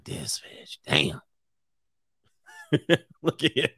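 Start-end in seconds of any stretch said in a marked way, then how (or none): 0.0-0.8 whispered aside. Retold or imitated. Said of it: "He like". 0.9-1.2 muttered quote. Retold or imitated. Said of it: "He like".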